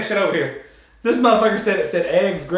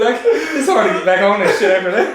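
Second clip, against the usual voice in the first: ordinary delivery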